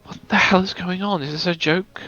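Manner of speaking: ordinary talk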